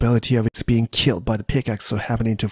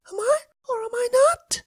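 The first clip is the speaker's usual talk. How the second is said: crying Elsa. Acted. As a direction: whimper it out, close to tears. Elsa doubts herself.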